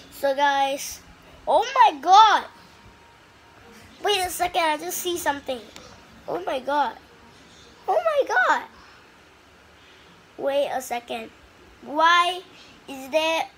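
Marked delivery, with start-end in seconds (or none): 1.5-2.4 loud, high startled cry; surprised shout. Real, not acted. Gamer spotting red mansions, loud surprised shout. Spots something in game. 7.9-8.6 very high squeak of disbelief; squeaky surprise. Real, not acted. Gamer seeing something odd, high squeal. Spots red mansions.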